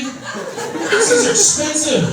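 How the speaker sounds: high, drawn out whine; slow high mock complaint. Comic to the crowd about cost, slow high whine. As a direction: stretch it out in mock outrage.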